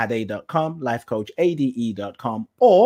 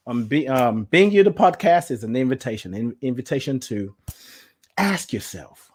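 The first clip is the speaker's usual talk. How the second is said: ordinary delivery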